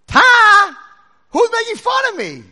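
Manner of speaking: high, very loud mimicked echo; echoed yell. Canyon throwing the kid's words back, yelling. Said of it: "And he hears".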